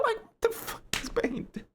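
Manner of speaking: high squeaky words through laughter; incredulous giggling shriek; laughing disbelief. Real, not acted. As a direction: choke it out mid-laugh in disbelief. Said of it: "while I'm laughing".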